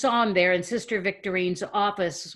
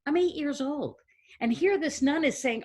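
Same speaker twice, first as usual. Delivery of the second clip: ordinary delivery, nothing marked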